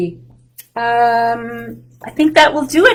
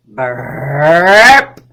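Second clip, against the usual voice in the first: long, loud, lower spoken burp; big mock burp; 7 semitones lower, 8 dB louder than usual. Teacher acts the boy's huge burp for the kids. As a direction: belch the word out big and silly.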